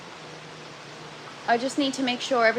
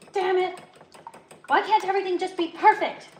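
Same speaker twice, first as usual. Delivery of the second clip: high-pitched exasperated whine; mock frustration; 8 semitones higher than usual. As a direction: plead against your bad luck, hammed-up. Acts out frustration at breakdown.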